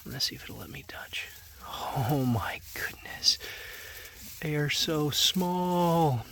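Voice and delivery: hushed whisper near piglets. Real, not acted. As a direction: whisper so you don't wake them. Near sleeping newborn piglets.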